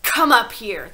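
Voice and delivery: loud stern order; shouted order. A narrator's reading. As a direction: shout it across the hall. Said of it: "the Trunchbull shouted".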